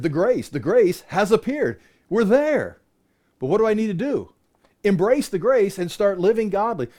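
fervent pulpit preaching; preaching at full force. Real, not acted. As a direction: build to a fervent peak. Rising preaching climax.

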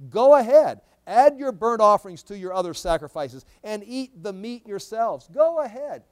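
loud, high, sarcastic dare. Acted. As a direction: mockingly urge them on, punching it out. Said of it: "This is sarcasm".

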